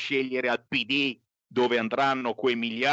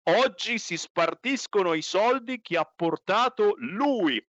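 mocking impression; 8 semitones higher than usual. Repeats Bersani quote mockingly.

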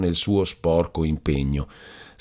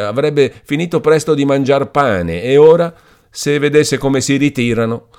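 put-on louder, higher, bitter young man's outburst; agitated young man; 6 semitones higher, 7 dB louder than usual. A narrator's reading. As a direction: rant with rising bitter laughter. Renzo vents, laughing bitterly.

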